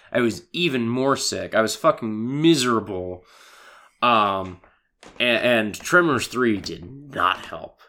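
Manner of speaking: ordinary talk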